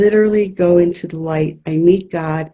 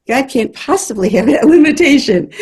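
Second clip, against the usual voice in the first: higher, louder retold protest breaking into a laugh; laughing disbelief; 8 semitones higher, 5 dB louder than usual. Healer recalls doubting the glasses rule, laughing disbelief. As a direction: recall your disbelief and let a laugh burst through. Retelling her incredulous thought, laughing.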